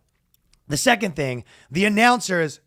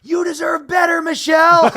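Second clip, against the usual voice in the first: shouted high and loud from the crowd; retelling a heckler yelling at the mayor, loud; heckler's shout; 11 semitones higher, 7 dB louder than usual. Retold or imitated. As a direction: yell it like a fan in the stands. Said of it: "One guy goes".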